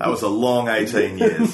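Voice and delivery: laughing. Real, not acted. Joke about enduring childhood.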